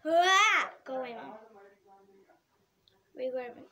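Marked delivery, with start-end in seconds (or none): 0.0-0.7 much louder, higher war whoop; loud gung-ho soldier. Acted. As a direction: let out a big battle cry. Sudden outburst.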